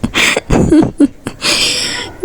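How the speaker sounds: breathy high-pitched giggling fit; high giggle. A narrator's reading. Ishika giggling at Neha, can't stop. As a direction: giggle helplessly, high and airy. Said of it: "फिर जो हमारी इशिका होती है बोलती".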